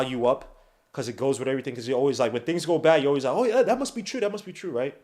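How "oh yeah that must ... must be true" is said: higher-pitched mimicking of eager believers; mock gullibility. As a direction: imitate someone falling for it. Mimics gullible fans believing rumours.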